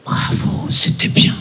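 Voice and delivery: whispered compliment. Retold or imitated. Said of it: "elle me dit".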